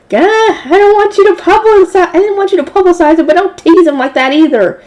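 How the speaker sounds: high, exasperated inner outcry; high-pitched, flustered complaint; panicked protest. Acted. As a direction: blurt it out in comic panic. Narrator's panicked inner outcry at priest.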